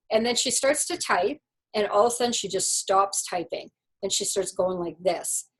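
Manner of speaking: ordinary talk, nothing marked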